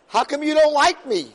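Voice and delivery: high, loud wounded plea; pleading complaint. Acted. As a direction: plead like a hurt leader to God. Moshe's complaint voiced.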